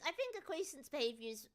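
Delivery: ordinary talk, nothing marked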